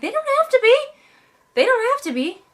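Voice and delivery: high, emphatic insistence; mock indignant protest; exasperated retort. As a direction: push back hard, voice up high. Mock retort to other stories.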